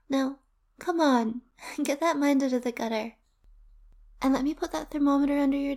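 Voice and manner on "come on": ordinary talk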